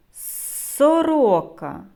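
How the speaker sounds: long hissed "с" opening "Сорока"; drawn-out S. Shown as a demonstration. Teacher helping children hear the sound, "Сорока" with long initial "с". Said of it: "щоб добре було чути звуки".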